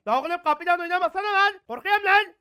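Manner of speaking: high, loud scared shouting; frightened yelling. Real, not acted. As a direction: yell out that you're terrified. Porte qui claque, effrayé.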